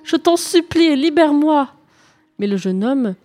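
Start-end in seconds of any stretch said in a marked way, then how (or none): 0.0-1.7 higher still, louder, begging harder; desperate pleading. Acted. Princess imploring the knight again, more desperate plea. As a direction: plead even more urgently. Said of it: "l'implora une nouvelle fois".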